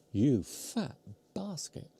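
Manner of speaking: whispered, slow and teasing; self-scolding whisper. Real, not acted. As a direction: hiss it at yourself, slowly. Scolding self over mince pies.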